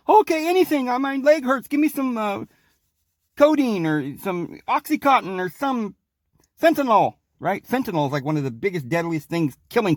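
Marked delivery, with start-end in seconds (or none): 0.1-7.1 much higher, begging mock patient; desperate patient. Patient begging the doctor for pills, high and hammed-up. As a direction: plead for pills like a hooked patient. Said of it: "the doctor's like".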